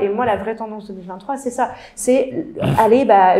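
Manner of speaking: normal talking voice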